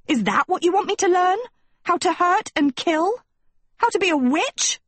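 put-on high-pitched girl's voice, appalled questions; Sophie, indignant. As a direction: play an outraged schoolgirl, pitched well up.

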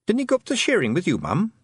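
high, hurried question; nervous. Acted. Tiffany to her worried mum, suddenly nervous. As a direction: ask it fast and high, suddenly uneasy. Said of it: "suddenly nervous".

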